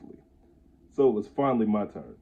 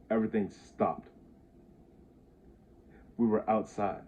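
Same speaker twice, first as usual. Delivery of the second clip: plain reading voice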